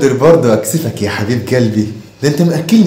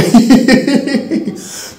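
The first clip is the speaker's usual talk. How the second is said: high-pitched cackle; mocking high laugh; 10 semitones higher than usual. Father mocking his son's grades, high cackle.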